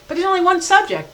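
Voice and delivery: teacher hammering the point; emphasis on one. Shown as a demonstration. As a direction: punch the word "one". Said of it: "let me make a really important point".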